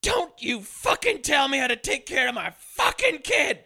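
hammed-up screaming mother defending herself; over-the-top junkie mom. Hammed-up junkie mom yelling at the undercover cop. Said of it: "giving a hundred and fifty percent".

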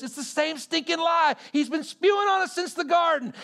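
loud, high, fierce preaching; full-force preacher shout. Real, not acted. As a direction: shout it out against the lie.

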